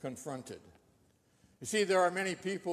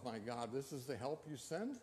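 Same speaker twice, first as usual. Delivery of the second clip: dismayed inner thought; 4 semitones lower, 10 dB quieter than usual. Retold or imitated. Woman's thought on seeing man.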